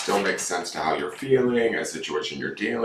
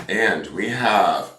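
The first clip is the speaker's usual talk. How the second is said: ordinary talk, nothing marked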